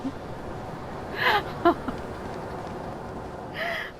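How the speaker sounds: laughing. Real, not acted. Streamer laughs at glitch.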